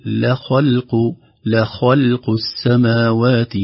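very slow, لخلق with ل thin, خ heavy, ل thin, ق heavy; correct tajweed. Shown as a demonstration. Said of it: "فينبغي أن نقول".

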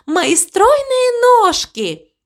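high, drawn-out proud exclamation; cow delighted with herself. A narrator's reading. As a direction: stretch the words out high, self-admiring. Cow sings her own praise.

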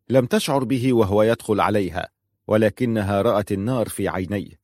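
plain reading voice

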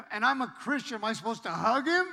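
high, loud incredulous question; comic bewilderment. Retold or imitated. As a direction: play up the disbelief, voice climbing. Mock bewilderment at crying bully.

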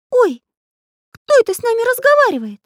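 very high, loud, quick cartoon surprise; startled. A narrator's reading. Pencil character hears unseen speaker, startled squeak. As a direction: yelp it in amazement, not whispered. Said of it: "удивлённо прошептал Карандаш".

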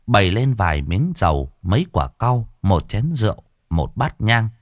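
ordinary delivery, nothing marked

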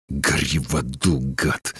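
low, hushed curse; seething. A narrator's reading. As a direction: force it out low and slow-burning. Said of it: "процедил он". (сквозь зубы, низко)

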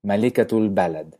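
malikatul balad, todo de corrido; árabe malikatul balad. Shown as a demonstration. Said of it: "uno dice reina el país". (malikatul balad en árabe)

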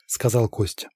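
plain reading voice

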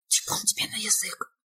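sharp hushed whisper. A narrator's reading. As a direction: scold in a whisper. Hushed reply in empty house.

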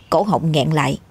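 plain reading voice

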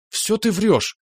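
high, squeaky, desperate cry; desperate squeal. Acted. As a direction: squeak it out in despair. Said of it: "отчаянно пропищала Марина". (отчаянный писк)

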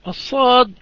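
الصاد with a hissing whistle on the s; الصاد said with sharp sibilance; whistled sad. Shown as a demonstration. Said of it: "لها صفير".

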